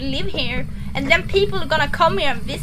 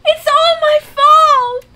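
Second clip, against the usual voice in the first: loud, high, slow wail of guilt; anguished cry; 10 semitones higher, 6 dB louder, slower than usual. Real, not acted. Panicked player blames herself.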